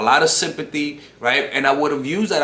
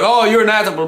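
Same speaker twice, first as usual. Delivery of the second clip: higher, louder imitation of her accusation; mimicking the girl calling him an asshole; mock offended girl; 7 semitones higher, 6 dB louder, faster than usual. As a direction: toss it off as her sulky jab. Mimicking girl's insult.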